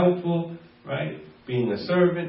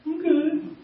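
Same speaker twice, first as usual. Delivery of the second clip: high, softer, shrugging; like it's no big deal, pitched up; dismissive; 10 semitones higher, 4 dB quieter, slower than usual. Retold or imitated. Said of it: "They say it with that kind of a tone".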